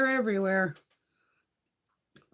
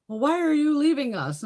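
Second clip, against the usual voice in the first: higher-pitched imitation of office staff; mock plaintive question; 8 semitones higher, 4 dB louder than usual. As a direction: act out a put-out receptionist, pitched up. Voices office staff questioning her.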